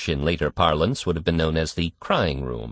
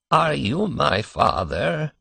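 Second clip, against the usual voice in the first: put-on higher, slow old man's voice; cracked ancient voice; 8 semitones higher, slower than usual. A narrator's reading. Old man in the crib asking his father, slow and cracked. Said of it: "spoke in a cracked and ancient voice".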